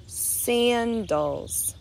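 "Sandals" slowly as saan, dals; syllables drawn out. Shown as a demonstration. Teacher lets preschoolers hear the parts, "Sandals" drawn out in two. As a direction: stretch both syllables of "Sandals". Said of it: "how many syllables we hear".

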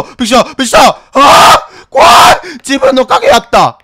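loud startled scream; hit hard crying out. Real, not acted. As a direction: scream in fright. Startled by own shadow.

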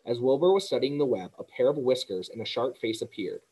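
ordinary delivery, nothing marked

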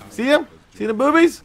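high loud giddy teasing; excited. Real, not acted. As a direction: squeal it gleefully. Teasing about character models.

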